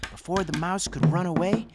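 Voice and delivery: plain reading voice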